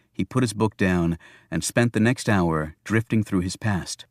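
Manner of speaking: plain reading voice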